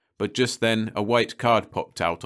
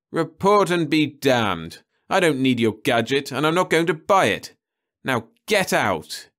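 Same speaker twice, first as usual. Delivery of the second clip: angry outburst; 7 semitones higher, 5 dB louder than usual. A narrator's reading. Perry furiously ordering the salesman out. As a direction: shout him out of the room.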